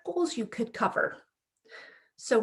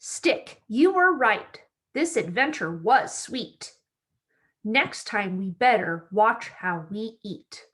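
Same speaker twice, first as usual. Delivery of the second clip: bright, lively storybook read-aloud; reading to children; slower than usual. A narrator's reading. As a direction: read it like story time for little kids. Reads picture book to children.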